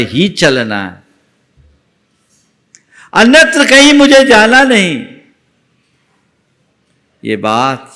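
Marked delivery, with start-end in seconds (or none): 3.1-5.1 high, loud preacher's vow; preaching at full force. Acted. Preacher urging the congregation, in a loud high proclaiming voice.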